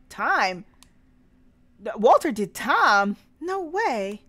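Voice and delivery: loud, swooping incredulous questions; shocked disbelief. Acted. Hearing that Walter did time, astonished disbelief. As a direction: blurt it out as if stunned, voice jumping up. Character reacts to shocking news.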